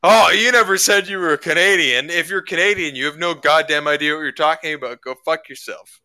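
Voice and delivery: retelling the American's rude reply with a mock shout; mock angry rant. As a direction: ham up the offended opponent's tirade. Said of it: "And the guy's like".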